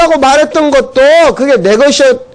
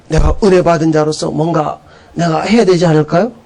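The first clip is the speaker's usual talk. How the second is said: ordinary delivery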